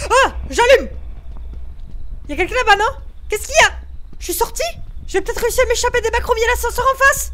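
high, loud frightened yelling; panicked shouting. Real, not acted. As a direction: yell in panic as you flee. Panicked escape in horror game.

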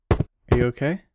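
normal talking voice

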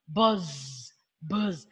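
low, soft buzzing; bee buzz. Shown as a demonstration. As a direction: hum it like a lazy bee. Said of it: "this is a bee".

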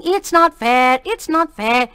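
mimicking a whiny sob on not; mock her tearful protest; a boy mimicking his friend's blubbering. A narrator's reading.

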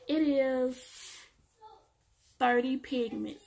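usual speaking voice

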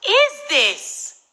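high, loud burst to end the question; indignant yelp at full volume; outraged shout. Retold or imitated. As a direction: explode on the last two words. Outraged reaction to the note.

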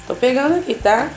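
ordinary delivery

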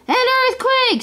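very high storybook shriek, moderate volume; high-pitched panicky exclamation; frightened scream. A narrator's reading. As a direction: cry out high in acted alarm. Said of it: "screamed Scrooge, frightened".

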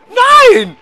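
second cry climbs into a shriek; shrieking shout. Real, not acted. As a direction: squeal it out in full panic. Game character about to die.

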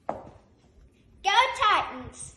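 slow, loud drawn-out cheer; cheerleader cheer. Acted.

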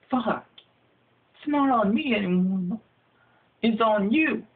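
high, teasing shout; gleeful gotcha. Acted. Victim gloating at his bro, high and mocking. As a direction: toss the mosquito blame back. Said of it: "And then you're like".

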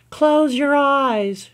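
high, very slow, soothing; soothing therapist. Acted. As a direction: lull her into calm. Therapist guiding, slow soft.

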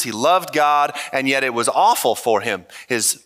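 ordinary delivery

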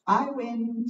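high, loud, beaming victory; warm beaming sun voice. Acted. The sun wins.

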